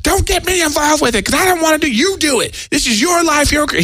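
high-pitched furious outburst, retold; angry yelling friend. Friend refusing to join the open mic, retold yelling. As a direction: yell it high, imitating a fed-up buddy. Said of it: "my friend Jeff yells at me".

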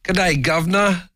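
put-on high, hammy British accent; a cheesy high British greeting. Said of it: "a mean British accent".

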